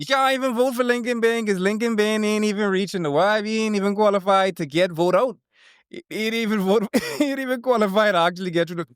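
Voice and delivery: high, laughing disbelief rant; incredulous laughing rant. Real, not acted. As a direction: scoff at the idea of him winning. Mocking the candidate, laughing.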